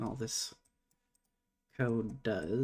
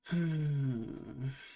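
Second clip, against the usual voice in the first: long voiced sigh; 2 semitones higher than usual. Real, not acted. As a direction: sigh it out slowly. Stuck on debugging trouble.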